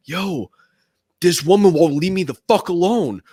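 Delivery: blurted aside with no subtlety; mock a friend's very obvious complaint; unsubtle aside. Friend complains about a woman within earshot, unsubtle. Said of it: "no subtlety about him".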